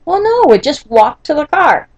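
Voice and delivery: retold objecting whine; dismissive protest. Husband arguing against fetching the chair, dismissive protest.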